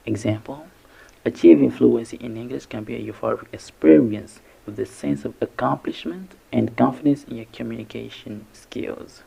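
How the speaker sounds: usual speaking voice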